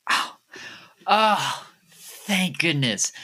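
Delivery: high, slow sigh of relief; acted relief. Woman hiding under the table, hugely relieved. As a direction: let out a big relieved breath. Hidden woman hears principal is gone.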